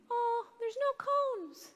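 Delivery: put-on high, slow child's voice; drawn-out child answer; kid voice. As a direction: sing it out like a small kid. Kids answering the teacher.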